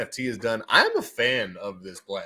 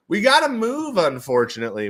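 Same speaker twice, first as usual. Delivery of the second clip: much higher, louder, playful; high mock-dramatic; 7 semitones higher, 5 dB louder than usual. Shown as a demonstration. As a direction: pitch it up like a cartoon. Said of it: "repeats it in a high voice".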